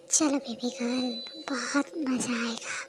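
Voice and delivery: slow, soft sleazy coaxing; creepy slow sweet-talk. Acted. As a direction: lure her in slowly. Lecher coaxing the girl.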